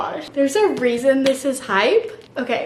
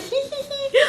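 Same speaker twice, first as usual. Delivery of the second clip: high-pitched imitated giggle; retold giggling; 14 semitones higher than usual. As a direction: mimic your own giddy, squealed tee-hee. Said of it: "I was verbally giggling".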